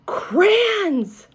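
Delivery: high delighted gasp of a word; very surprised. A narrator's reading. A girl opening her gift, very surprised. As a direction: burst out in wonder at the gift. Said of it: "I said, very surprised".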